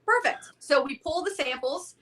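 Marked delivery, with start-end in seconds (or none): 0.0-0.4 surprised. Retold or imitated. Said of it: "And I was like".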